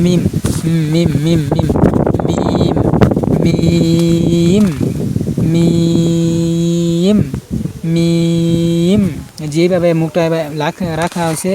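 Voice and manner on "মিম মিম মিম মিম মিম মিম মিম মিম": মিম short at first, then stretched on a level note; drawn-out মিম with a humming close; nasal lip-closed M. Shown as a demonstration. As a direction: press the lips together and let মিম ring in the nose. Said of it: "নাকের মধ্যে বাজবে উচ্চারণের সময়".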